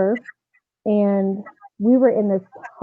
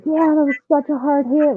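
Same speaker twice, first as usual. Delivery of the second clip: teammates consoling; 6 semitones higher than usual. Retold or imitated. Teammates' consoling remark retold.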